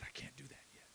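barely audible, retold reluctant reply; hushed refusal. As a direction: say it almost under your breath. Broken man's reluctant answer retold.

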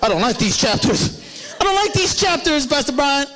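high, laughing complaint in a put-on voice; mock whiny congregant. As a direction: whine it like a fed-up churchgoer.

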